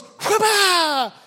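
goat karate cry. Retold or imitated. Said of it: "He comes up and goes".

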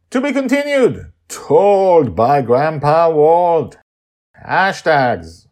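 loud, high, slow announcer credits; podcast outro. Acted. Host closing the episode, announcer voice. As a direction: spell out the credits grandly.

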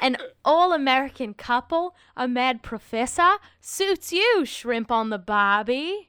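hammed-up Australian agent; mock Australian. Agent pitches the play, hammed-up Australian accent. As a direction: lean into a badly faked Australian accent. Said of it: "I don't know why I'm Australian now".

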